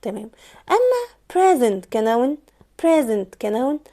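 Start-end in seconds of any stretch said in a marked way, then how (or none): 1.3-1.9 "present" as PREZ-ent; stress on first syllable. Shown as a demonstration. Teacher contrasting the noun with the verb, "present" as PREZ-ent. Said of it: "انا المقطع اللي نطقته بقوه هو المقطع الاول". 2.8-3.4 noun "present", PREZ-ent; stress on first syllable. Shown as a demonstration. Teacher repeating the noun form, "present" as PREZ-ent. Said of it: "انا المقطع اللي نطقته بقوه هو المقطع الاول".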